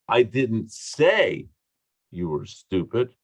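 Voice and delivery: heavy emphasis on "say", implying it was only thought; stress on SAY. Shown as a demonstration. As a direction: lean hard on "say", rest falls away. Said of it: "based on what I emphasize".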